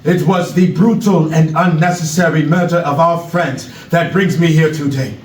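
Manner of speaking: put-on measured speech in an African accent; Knowledge, African accent. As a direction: address the crowd gravely with an African accent. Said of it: "African accent".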